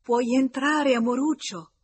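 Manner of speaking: put-on loud, high call to a child outside. A narrator's reading. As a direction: call through the door to your little darling. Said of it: "La signora Desmarest gridò".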